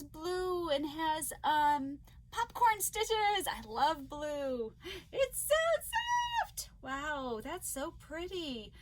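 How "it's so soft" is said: high, raised gush; delighted squeal. Real, not acted. Feeling the gifted yarn, delighted squeal.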